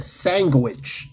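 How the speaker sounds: "sandwich" drawn out as SANG-gwitch. Retold or imitated. Quoting his Guyanese friend, sandwich as SANG-gwitch. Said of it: "said sandwich with a G".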